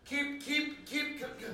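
high wife voice calling upstairs; calling from far away. Acted. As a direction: shout up to someone in another room.

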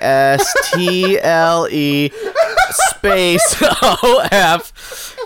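slow letters broken by laughs; spelling through laughter. Real, not acted. Host spells the title for the listener, laughing through it. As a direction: spell it out while cracking up.

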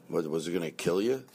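ordinary delivery

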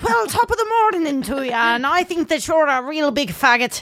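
high, hammed-up mock Irish brogue; bad Irish accent. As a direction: lay on a cartoon Irish lilt. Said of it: "I can do me best, Irish".

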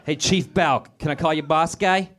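hammed-up Boston accent; mock Boston accent. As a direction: greet him like a swaggering Boston local. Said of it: "that's how they talk out there".

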